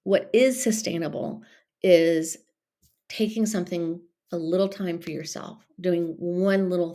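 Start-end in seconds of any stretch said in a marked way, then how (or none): none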